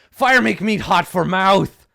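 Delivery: slow, loud broken-English gnoll; complaining gnoll. Acted. Gnoll protests to Puzzle about hot meat, slow and loud. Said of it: "the gnoll is like".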